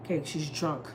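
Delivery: soft and low, calming; quiet excuse. Retold or imitated. Wife softly excusing the drunk girl to her husband, hushed. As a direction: say it under your breath to settle him. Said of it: "I'm like".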